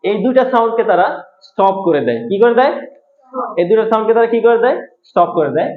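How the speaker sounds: ordinary talk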